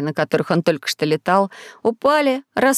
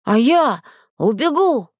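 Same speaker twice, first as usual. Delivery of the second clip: put-on slow, high little-boy bravado; plucky, drawn-out declaration; brave; 5 semitones higher, slower than usual. A narrator's reading. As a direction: boast it boldly as a small child. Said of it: "храбро сказал Зайчик".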